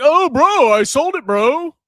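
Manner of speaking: high, loud mock whine of a panicked seller. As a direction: mimic him caving, hammed up.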